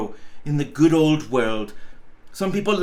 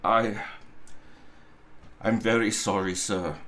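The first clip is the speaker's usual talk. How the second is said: timid apology; 7 semitones lower, 7 dB quieter than usual. Acted. Timid clerk apologizes to boss.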